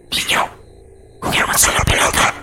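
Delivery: eerie unvoiced child's whisper; whispering child ghost. A narrator's reading. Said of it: "la pequeña voz susurrante".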